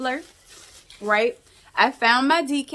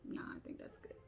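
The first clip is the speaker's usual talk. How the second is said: whispered to herself; a private whispered verdict; 24 dB quieter, faster than usual. Real, not acted. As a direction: murmur it under your breath. Muttering to herself while crafting.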